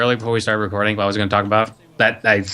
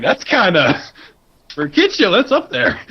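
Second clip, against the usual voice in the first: laughing; 10 semitones higher than usual. Real, not acted. Reacting to kid show.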